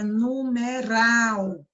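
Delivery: "numeral" devagar, com RAL mais forte e alongado; ênfase na última sílaba. Shown as a demonstration. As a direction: pronuncie "numeral" carregando na sílaba final RAL. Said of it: "a última sílaba você fala com mais força". ("numeral" com força na última sílaba, RAL)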